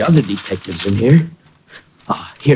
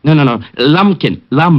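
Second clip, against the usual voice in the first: insistent correction; 2 semitones higher, 7 dB louder than usual. Acted. Correcting name loudly.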